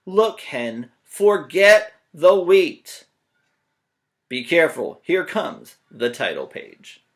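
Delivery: loud, slow stern warning; loud stern Jack. Acted. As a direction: shout the hen off the page. Jack warns.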